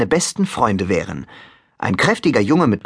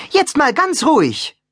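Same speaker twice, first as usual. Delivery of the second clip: higher-pitched, placating heroine; raised voice, carefully introducing herself; diplomatic Annabeth. Acted. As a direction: reason with an angry god. Annabeth tries to placate Terminus.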